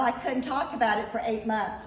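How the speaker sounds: usual speaking voice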